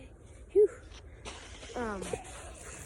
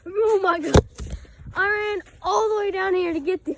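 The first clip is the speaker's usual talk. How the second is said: excited; 7 semitones higher, 6 dB louder than usual. Real, not acted. Sled run goes wrong.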